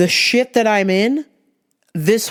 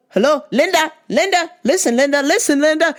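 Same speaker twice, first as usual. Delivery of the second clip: raised pitch, nagging for attention; insistent calling; 7 semitones higher than usual. Retold or imitated. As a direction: keep calling, trying to get through. Calling for mom's attention on phone.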